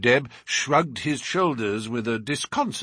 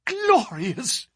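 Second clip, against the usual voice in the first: gasped; 13 semitones higher than usual. A narrator's reading. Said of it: "gasped our host".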